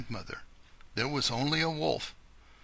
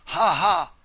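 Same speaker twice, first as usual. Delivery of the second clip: gloating laugh; 7 semitones higher, 12 dB louder than usual. Acted. Wolf gloats over his plan.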